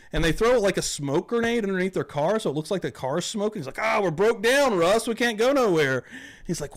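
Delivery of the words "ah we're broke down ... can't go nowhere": high, whiny mock complaint; put-on helpless wail, pitched up; feigned dismay. YouTubers faking a breakdown to Russ, mock dismay.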